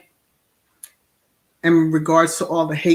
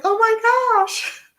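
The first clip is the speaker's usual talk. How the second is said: much higher, lightly retold gasp of awe; amazed oh my gosh; 6 dB louder than usual. Telling a viewer how she reacted to the singing, high mock awe. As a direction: replay your awe, pitched way up. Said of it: "I was like".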